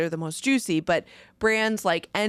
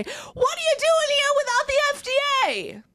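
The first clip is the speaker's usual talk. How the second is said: Sonya. Acted. Host impersonating someone asking about the FDA, squeaky put-on voice. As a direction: put on a squealing, exaggerated character voice. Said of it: "put on my Sonya voice".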